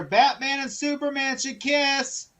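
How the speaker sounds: high, drawn-out mock heckle; slow sing-out call, pitched well up; retold yell. Someone in the theater heckling the movie, high mock yell. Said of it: "someone yelled in the theater".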